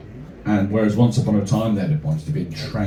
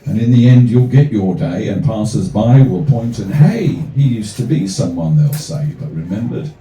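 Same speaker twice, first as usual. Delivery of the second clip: measured rhythmic poem reading; poem recital; 5 dB louder than usual. Acted. Comic poet reading his verse to the crowd, rhythmic recital. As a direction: recite it with a steady, rhyming lilt.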